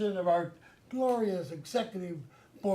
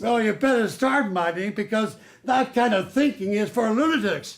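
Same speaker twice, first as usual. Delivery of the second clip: scolding boss; 3 semitones higher, 6 dB louder than usual. Acted. Boss scolding new manager.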